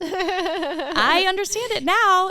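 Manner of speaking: high, loud, very slow laughing sigh; mock realisation through laughter; laughing relief. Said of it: "I'm like".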